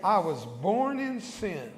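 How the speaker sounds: slow, measured phrases with long pauses; solemn sinner's prayer, spoken clearly; led prayer. Real, not acted. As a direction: give each line slowly so the church can repeat it. Leads congregation in repeat-after-me prayer.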